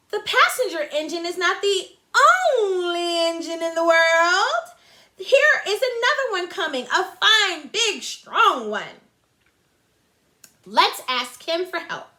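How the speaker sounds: high, bright excited clown voice; cheery little clown. Acted. Toy clown urging the dolls, high excited voice. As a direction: call out like an eager toy clown. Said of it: "the little clown called out".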